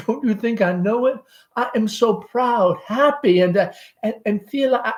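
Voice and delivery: high nervous Southern mother; anxious mother Amanda. Acted. Amanda voiced by reader.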